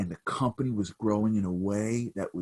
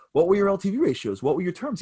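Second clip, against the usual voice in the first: higher, louder rapid questions; brisk grilling; 10 semitones higher, 6 dB louder than usual. Retold or imitated. Old man grilling the desperate CEO, brisk and probing. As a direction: press him for details.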